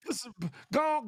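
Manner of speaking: ordinary talk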